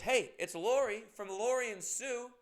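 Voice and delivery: bright imitation of an eager fan; quoting Lori. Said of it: "She goes".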